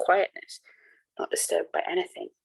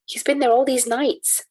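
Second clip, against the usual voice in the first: high, slow, pleading outburst; eager cry; 8 semitones higher, 4 dB louder, slower than usual. A narrator's reading. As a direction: cry it out, desperate to be believed. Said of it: "I cried".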